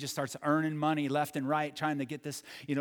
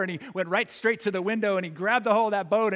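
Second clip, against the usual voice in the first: plain reading voice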